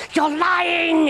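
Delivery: high, fierce accusation; furious hiss. Acted. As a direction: spit the accusation out high and hard. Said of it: "hissed Salome".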